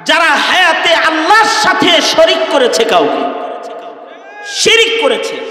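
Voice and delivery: usual speaking voice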